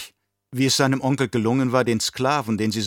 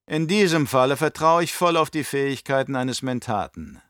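put-on scheming old Baron; 7 semitones higher than usual. Baron to his nephew, raised and sly. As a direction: play the cunning uncle sounding out his nephew.

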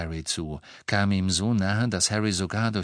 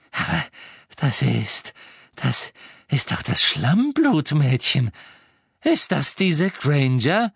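startled recognition; 6 semitones higher, 5 dB louder, slower than usual. A narrator's reading. Villainess recognises the prisoner.

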